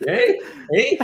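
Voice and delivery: high, laughing Canadian accent, going up at the end; Canadian upspeak. Shown as a demonstration. Joking to friends about his picked-up twang, Canadian upspeak. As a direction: exaggerate the Canadian questioning lift. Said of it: "they always go upwards at the end and everything sounds like a question".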